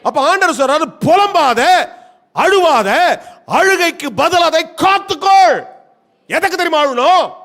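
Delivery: loud, high, commanding pulpit cry; shouted divine command from the pulpit; full-force preaching. Acted. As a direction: thunder out each command separately.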